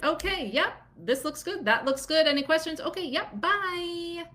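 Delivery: mock hurried pediatrician; rushed doctor. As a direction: hurry through like a busy OB. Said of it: "their pediatrician or O B who is".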